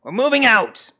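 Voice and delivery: high, loud rallying call; yelled command. Acted. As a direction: call the troops out in character. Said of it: "and she kinda yells".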